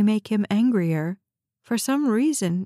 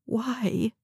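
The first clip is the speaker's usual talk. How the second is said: small, hushed, fearful question; trembling voice; 4 dB quieter than usual. A narrator's reading. Shaken visitor questioning the man who betrayed her, trembling. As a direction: ask it faintly, with a touch of tremble. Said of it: "Her voice trembled".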